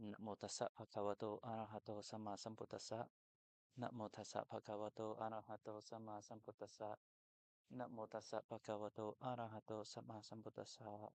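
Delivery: quick, soft, even chanting; hushed, rapid devotional murmur of Pali; Pali homage recitation. Real, not acted. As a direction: recite the homage quietly and steadily on one tone. Recites opening homage formula thrice.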